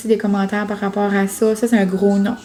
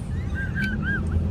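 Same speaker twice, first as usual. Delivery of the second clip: high, helpless laugh; real squealing laughing fit; cracking up laughing; 9 dB quieter than usual. Real, not acted. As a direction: burst into soft, very high laughter. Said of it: "La gang, je suis crampée".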